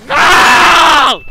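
long, high, full-volume shout; anguished scream. Real, not acted. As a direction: scream it out at the screen. Game death.

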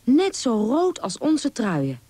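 high, delighted-discovery reading; surprised. A narrator's reading. Young squirrel comparing berries to sweaters, surprised. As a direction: marvel at the match. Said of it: "riep Diewertje verrast".

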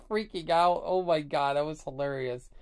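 ordinary delivery

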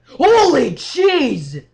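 long, loud scream of fright; startled scream. Real, not acted. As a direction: drag it out in real shock. Jump scare in game.

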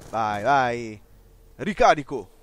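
ordinary delivery

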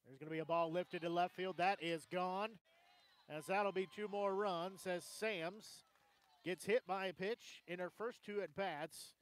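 loud, excited sportscaster; excited home-run call. Real, not acted. Sportscaster calling a homer to left field, excited. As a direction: raise the call as the ball leaves the park.

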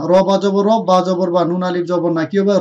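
normal talking voice

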